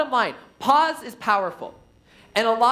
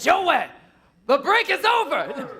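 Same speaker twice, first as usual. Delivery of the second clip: high, raised teasing announcement; someone calling Joey; 7 semitones higher than usual. Acted. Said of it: "someone says".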